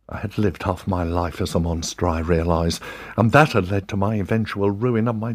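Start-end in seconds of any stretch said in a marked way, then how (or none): none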